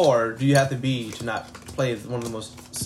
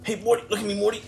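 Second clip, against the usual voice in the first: higher, cartoonish mad scientist voice; Pickle Rick impression; 7 semitones higher than usual. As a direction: ham up a crazed cartoon scientist.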